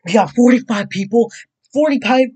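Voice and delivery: loud, high, disbelieving cry; shocked excitement. Real, not acted. Streamer seeing forty-five subscribers, stunned excited shout. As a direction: blurt it out in happy shock.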